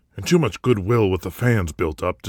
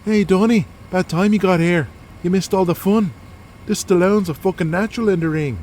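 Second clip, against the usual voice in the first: boisterous Irish wrestler; 11 semitones higher than usual. Acted. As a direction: rib him like a rowdy mate. Killian greets Donnie, boisterous wrestler.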